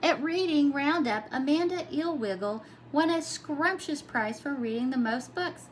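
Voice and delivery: ordinary delivery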